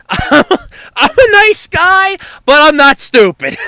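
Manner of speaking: giggling through the words; laughing. Real, not acted. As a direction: laugh while you say it. Joking self-description, laughing.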